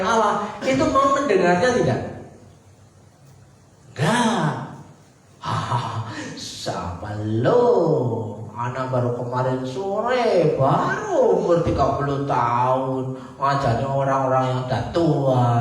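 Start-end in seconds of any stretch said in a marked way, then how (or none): 3.9-15.5 lower, mocking scoff at a young upstart; scornful traders. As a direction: sneer it like an old market hand brushing off a kid.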